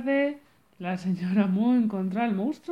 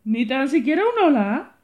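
loud, offended complaint; scold, affronted; indignant; 3 semitones higher, 7 dB louder than usual. Acted. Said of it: "dijo la señora Mo". (indignada, en voz alta)

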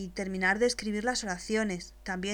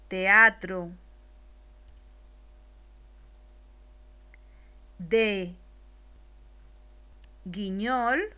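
very slow, each word set apart by long pauses and said louder; dictating word by word; 3 dB louder, much slower than usual. Shown as a demonstration. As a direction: dictado lento, palabra por palabra. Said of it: "después te la dicto". (dicta despacio y claro, pausa tras cada palabra)

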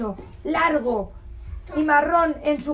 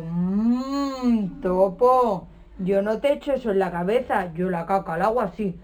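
cow voice; 6 semitones lower than usual. Acted. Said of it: "y la vaca dijo".

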